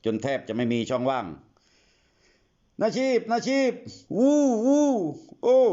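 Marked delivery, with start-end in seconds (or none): 2.0-5.6 very high, slow drawn-out calls with loud whoops; hollering into the dark for anyone to hear; desperate calling. Acted. Lost boys call for Nachip in the stormy forest, long high shouts and whoops.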